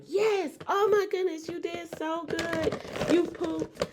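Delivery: high, overjoyed cheering; potty training praise. Retold or imitated. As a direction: celebrate like a proud mom. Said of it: "we just celebrated, like".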